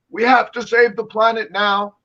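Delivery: loud, high-pitched impassioned plea; mock climate activist. Mimicking a green advocate he says he isn't, mock activist cry. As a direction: cry it out like an alarmed campaigner, mockingly. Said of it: "I've never been a climate green advocate".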